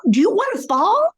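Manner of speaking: an impatient caregiver yelling at her mother; yelling reprimand. Shown as a demonstration. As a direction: shout the question in exasperation. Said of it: "It's a yell".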